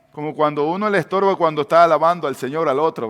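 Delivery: ordinary talk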